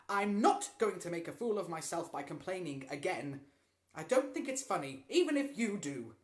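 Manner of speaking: loud, high scolding mother; very angry. A narrator's reading. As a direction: give him a furious telling-off. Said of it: "Missus Hunter was very angry".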